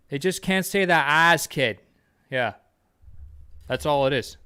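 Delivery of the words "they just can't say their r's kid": higher-pitched Boston accent, dropped R's. Shown as a demonstration. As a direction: talk like a Boston local who can't say R's. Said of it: "It's fucking Boston, kid".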